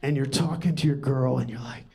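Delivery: usual speaking voice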